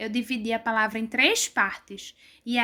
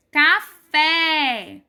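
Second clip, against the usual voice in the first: Café split in two, louder long fé; Café with a strong, held last syllable; stress on FÉ; 2 semitones higher, 5 dB louder than usual. Shown as a demonstration. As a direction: land hard on the fé of Café. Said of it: "Vocês concordam comigo que é o fé?".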